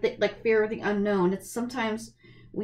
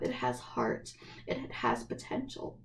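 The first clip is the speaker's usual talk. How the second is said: much lower and softer, teary; low choked murmur; 10 semitones lower, 9 dB quieter than usual. Real, not acted. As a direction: swallow the words through tears. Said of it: "I've been crying for, like, an hour".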